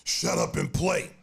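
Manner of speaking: loud, drawn-out dismissive command; mock opponent's loud put-down; retold angry shout. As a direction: shout it back at a complaining opponent. Opponent barking back in retold scuffle.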